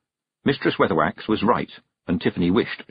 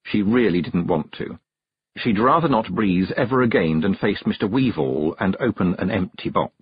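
ordinary delivery

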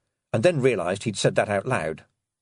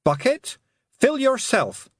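commanding; 4 dB louder, slower than usual. A narrator's reading. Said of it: "she commanded".

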